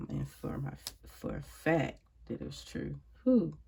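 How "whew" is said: loud emotional whew. Real, not acted. Overwhelmed recalling father's death.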